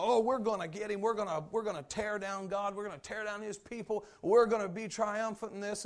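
loud, boastful taunt of proud kings; a loud, boastful mock of earthly kings. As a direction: ham up the rulers' bragging. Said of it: "these kings and these rulers are so loud".

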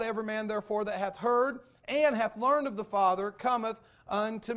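loud, high pulpit preaching; fiery preacher pressing the congregation; preaching at full force. Acted. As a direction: drive the text home to the church.

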